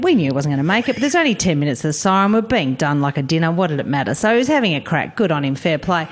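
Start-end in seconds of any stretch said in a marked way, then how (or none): none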